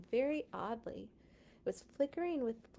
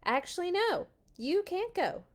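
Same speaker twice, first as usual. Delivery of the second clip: higher, louder cheeky refusal; smug reversal; 9 semitones higher, 5 dB louder than usual. Acted. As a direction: take it back with a smirk. Pharaoh changes his mind.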